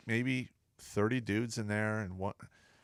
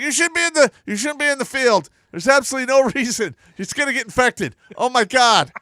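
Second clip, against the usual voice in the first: high, loud re-enacted rant; imitated sergeant hollering in disbelief; alarmed scolding; 12 semitones higher, 11 dB louder than usual. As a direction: scold in mock panic.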